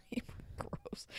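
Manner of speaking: whispered, grossed out; hushed disgust. Real, not acted. Disgusted aside.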